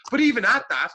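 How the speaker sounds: bursting with admiration, hit the days high; excited emphasis. Real, not acted. Praising Peter for running before work, excited and high.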